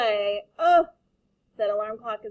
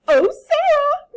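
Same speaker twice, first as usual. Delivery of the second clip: very high, loud, slow gush; 15 dB louder, slower than usual. Acted. Principal greeting the new pupil, very high loud gushing. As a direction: gush over her like a delighted principal. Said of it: "Missus Burton gushed".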